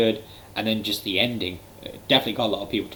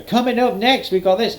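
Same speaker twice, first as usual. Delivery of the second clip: sudden high, louder chirpy show-host pivot; mock upbeat announcer; 12 semitones higher, 6 dB louder than usual. Mocking commentators switching to hype the next segment, high and chirpy. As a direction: snap back to cheery hype as if nothing happened. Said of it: "they go".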